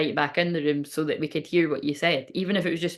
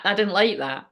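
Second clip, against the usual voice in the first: ordinary talk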